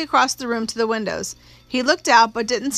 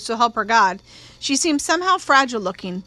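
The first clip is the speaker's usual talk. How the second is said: plain reading voice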